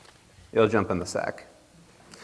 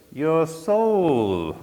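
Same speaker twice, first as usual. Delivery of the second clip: devil voice; 6 semitones higher than usual. Acted. Devil demands Jack's soul.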